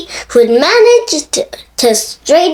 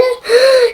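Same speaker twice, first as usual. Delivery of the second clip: gasp; 11 semitones higher than usual. A narrator's reading. Gasping at naughty deed.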